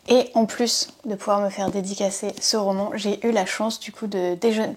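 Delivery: usual speaking voice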